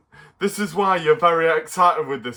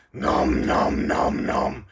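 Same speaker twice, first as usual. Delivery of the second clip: munching creature voice; 5 semitones lower, slower than usual. Acted. Puppet Stripe rude remark.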